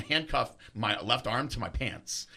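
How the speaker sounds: normal talking voice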